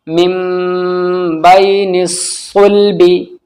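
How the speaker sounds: slow recitation, long hummed m in "मिम"; flowing recitation. Shown as a demonstration. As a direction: linger on "मिम", then draw out "बैनिस सुल्बी". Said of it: "रवानगी से पढ़ते हैं". ("मिम" पर गुन्ना, धीमी तिलावत)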